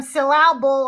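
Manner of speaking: syllable said as sil-LAB-ul; syllable with a deliberately misplaced stress on LAB; wrong-syllable stress. Shown as a demonstration. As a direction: jokingly stress the second syllable of syllable. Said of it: "the emphasis on the wrong syllable".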